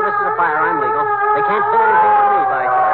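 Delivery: loud, high, cocky bluster; defiant shout. Acted. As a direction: stand your ground and shout it out. Defiant husband as firemen arrive.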